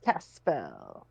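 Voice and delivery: low, hushed spell-casting; whispered spell. Pretend. Player quietly casting on a rat folk, whispered. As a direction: drop low and murmur the spell. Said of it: "cast this whispered spell".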